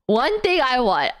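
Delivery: ordinary talk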